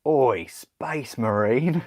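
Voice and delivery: slow, loud, high Cockney ork bellow; hammed-up British ork shout; Ork British accent. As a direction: drag it out like a yobbish British ork. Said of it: "do they talk in, like, British accents".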